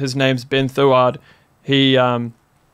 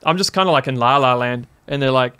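usual speaking voice

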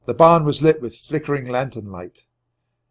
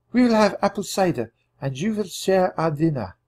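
high, gentle woman's voice; a French farmwife comforting hiding children; Suzette; 8 semitones higher than usual. A narrator's reading. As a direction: reassure the children as a kind mother.

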